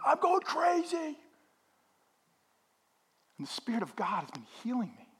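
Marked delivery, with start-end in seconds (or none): 0.0-1.2 high, drawn-out mock frantic cry; frantic outburst. Pastor recalling his stair ritual, mock frantic. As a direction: act out losing it, pitched way up. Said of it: "I'm like".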